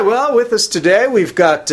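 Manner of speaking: ordinary delivery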